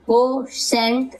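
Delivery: कोशेंट slowly split into "ko" and "shent"; syllable by syllable. Shown as a demonstration. Teacher recapping examples for learners, कोशेंट in slow separated parts. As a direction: draw out कोशेंट with a gap before "shent". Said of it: "इस प्रकार से आप सेंट".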